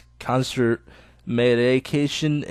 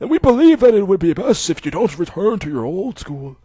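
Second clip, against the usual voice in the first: put-on higher, louder, measured old doctor; 6 semitones higher, 5 dB louder than usual. Old doctor telling Hisao he can go home.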